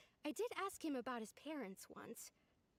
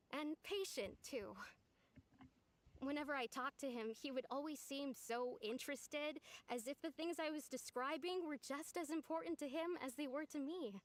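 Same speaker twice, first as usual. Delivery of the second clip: usual speaking voice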